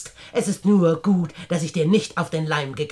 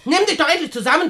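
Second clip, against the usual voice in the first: much higher and fast, a cutting reprimand; sharp rebuke; 9 semitones higher, 4 dB louder, faster than usual. Acted. Aunt Edna scolds her hysterical companion, sharply and fast.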